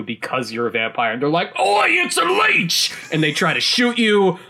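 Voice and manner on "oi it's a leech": high, slow, hammed-up Cockney yell; mock Cockney shout; bad Cockney accent. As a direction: ham up a bad Cockney thug spotting a leech. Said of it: "really bad Cockney accents".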